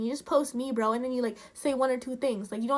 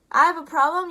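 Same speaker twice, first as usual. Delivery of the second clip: louder, higher, incredulous question; indignant disbelief; 4 semitones higher, 8 dB louder than usual. Real, not acted. Creator firing back at a man who accused them, indignant. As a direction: hit it harder, affronted and rising.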